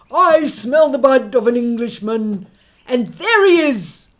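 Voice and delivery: very loud booming roar; roaring giant. Acted. Giant chasing Jack, roaring. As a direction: roar each word like a giant. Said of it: "roared the giant".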